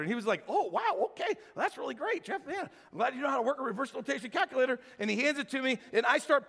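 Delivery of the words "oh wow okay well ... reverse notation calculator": high-pitched, swooping mock amazement; mock impressed. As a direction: act out an over-impressed colleague, pitch leaping up. Acts out colleague's reply to demand.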